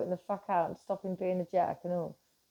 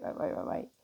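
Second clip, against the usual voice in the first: breathy whispered repeated waits; whispering wait, wait; 5 dB quieter than usual. Retold or imitated. Frantic stopping of the others.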